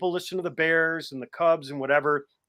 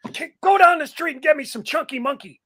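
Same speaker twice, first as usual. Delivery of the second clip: imitating McNeil; 11 semitones higher, 5 dB louder than usual. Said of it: "I remember McNeil".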